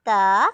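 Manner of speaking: ता said light and thin; a fine, light ता. Shown as a demonstration. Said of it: "ता बारीक है".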